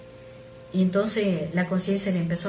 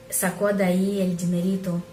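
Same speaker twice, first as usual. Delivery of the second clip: usual speaking voice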